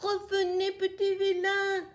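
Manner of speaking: a hen grumbling after her runaway eggs; cackling grumble. Acted. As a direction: squawk it like a cross hen. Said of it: "Elle caquette et râle".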